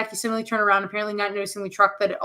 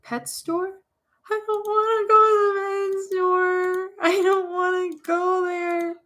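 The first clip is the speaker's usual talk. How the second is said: put-on high, slow, frightened little kid voice; a small child begging not to go; whiny scared child; 9 semitones higher, slower than usual. A little child pleading not to go to the pet store, high and slow.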